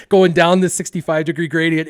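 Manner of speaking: ordinary talk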